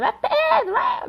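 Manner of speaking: a girl imitated shouting her friend's name across the park; child shouting. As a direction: shout it like a kid playing in the park. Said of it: "gritando no parque pra caramba".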